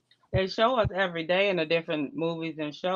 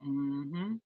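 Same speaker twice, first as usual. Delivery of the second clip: mouth full; 5 dB quieter than usual. Real, not acted. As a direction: hum through a mouthful of food. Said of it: "You caught me with my mouth full".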